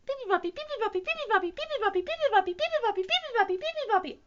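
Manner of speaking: very high, loud birdsong chatter; squeaky rapid-fire chirping; chirping little bird. A narrator's reading. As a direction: pipe it out like a tiny tireless bird. Bird keeps singing all night.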